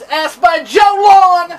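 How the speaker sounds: loud, slow, high ring announcer call; announcer shout. Acted. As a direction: stretch the name out like a ring announcer. Announcing the questioner.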